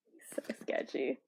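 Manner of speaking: a low, breathy whisper under the breath; whispered aside. Real, not acted. Whispering to herself about the sketchy villain, hushed. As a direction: whisper it like a secret.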